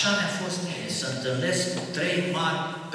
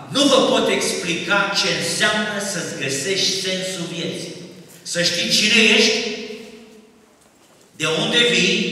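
high, loud exhortation with long pauses; full-force preaching; 8 semitones higher, 10 dB louder than usual. Real, not acted. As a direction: drive each phrase out forcefully to the crowd.

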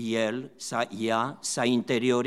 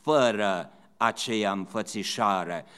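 normal talking voice

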